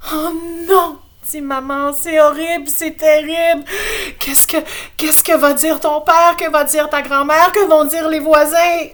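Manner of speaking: high, loud, frantic mother; panicking mother. Acted. Mother hearing about the ticket, high frantic wail.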